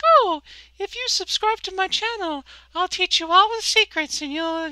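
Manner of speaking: high, loud, hammed-up sales pitch; mock YouTuber pitch. Mocking online gurus who promise secrets, in a high hyped-up pitch.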